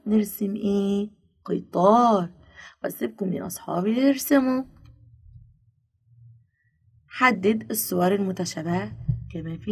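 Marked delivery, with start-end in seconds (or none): none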